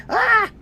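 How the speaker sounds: high squeaky cartoon yelp; cartoon scream. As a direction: shriek like a fleeing snack. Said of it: "like, goes".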